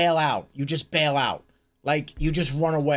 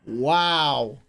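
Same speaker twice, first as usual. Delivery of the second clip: ordinary talk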